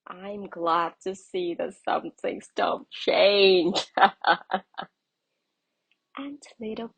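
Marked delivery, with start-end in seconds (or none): 0.1-3.9 slow, louder amused owl; fond and knowing; lightly voiced laughing owl; chuckling old owl. A narrator's reading. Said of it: "Old Owl laughed". 3.9-4.8 loud amused chuckle; a fond old laugh; hearty storybook laugh; owl's laugh. A narrator's reading. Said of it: "Old Owl laughed".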